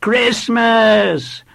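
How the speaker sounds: put-on high, loud little boy's call; volunteer the answer like an excited schoolboy; eager small boy. A narrator's reading. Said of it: "volunteered a small, wiry boy".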